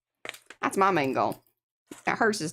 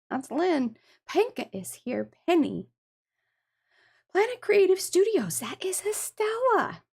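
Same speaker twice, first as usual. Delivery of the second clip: ordinary talk, nothing marked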